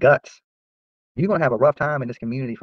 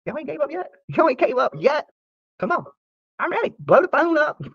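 mocking taunt; 12 semitones higher than usual. Vlogger daring haters to keep calling, high mocking taunt. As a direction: goad them to keep trying. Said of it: "laughing at you like".

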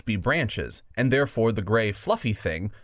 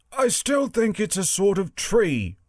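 high warthog character voice; 10 semitones higher than usual. Acted. Warthog holding to its tree theory, high warthog voice. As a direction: pitch it up as a stubborn warthog. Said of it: "said the warthog".

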